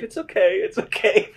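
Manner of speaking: high-pitched mimicked soothing; high retold reassurance; imitated reassurance. Imitates Nadia reassuring him.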